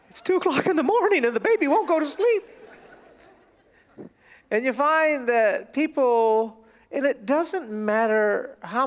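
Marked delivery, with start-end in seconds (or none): 0.1-2.4 high, rushed mock wail; frazzled parent. Parent up at night with baby, frazzled. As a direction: complain in a high, hurried voice. Acting exhausted parent's complaint.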